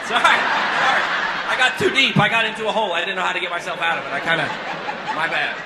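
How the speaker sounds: loud, high, laughing through an apology. Real, not acted. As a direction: apologize while cracking up at yourself. Laughing apology after kidding the crowd.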